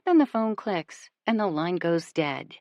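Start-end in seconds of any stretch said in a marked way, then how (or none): none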